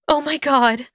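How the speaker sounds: high, shocked Hermione voice; high-pitched horrified gasp. A narrator's reading. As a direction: gasp it in horror, pitched well up. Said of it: "she gasped".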